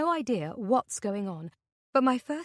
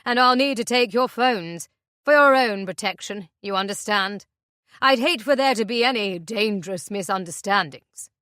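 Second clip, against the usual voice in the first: menacing captor; 10 dB louder than usual. Acted. Captor demands their phones.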